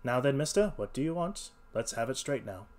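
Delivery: usual speaking voice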